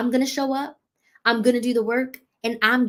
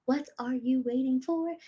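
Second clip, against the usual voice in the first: the same question asked again, softer and drawn in; softer repeat; 3 semitones higher, 5 dB quieter than usual. Shown as a demonstration. Coach urging viewers to sign up, a softer second asking. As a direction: let the repeat drop softer, almost to herself.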